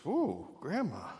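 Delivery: a slow, high, wide-eyed ooh; childlike drawn-out wonder; mock amazement. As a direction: play the girl gasping at grandma. Girl marvels at disguised grandma.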